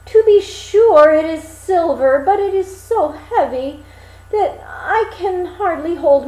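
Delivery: a simple lad grumbling about his burden; mock woeful complaint; Hans complaining.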